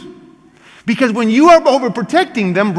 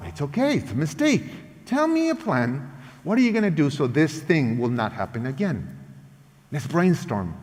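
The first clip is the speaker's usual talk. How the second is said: put-on soft and gentle, easing a child's worry; calm reassuring parent; 6 semitones lower, 8 dB quieter than usual. Parent reassures child after a mistake, soft and patient. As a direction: hush it down, no blame, just patience.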